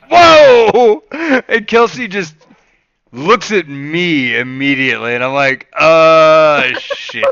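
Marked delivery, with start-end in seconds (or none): 0.1-1.0 loud, high, drawn-out reaction; shocked exclamation. Retold or imitated. Dad reacts to Max swearing, retold shock. 5.8-7.3 loud, drawn-out groan breaking into laughter; retold dread. Dad caught by Kelsey's look, laughing mock dread.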